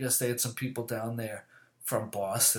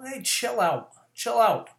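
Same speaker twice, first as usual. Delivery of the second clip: calling chill out; 9 semitones higher, 6 dB louder than usual. Retold or imitated. Telling someone to calm down.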